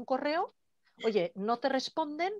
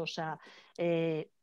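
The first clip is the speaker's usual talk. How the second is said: normal talking voice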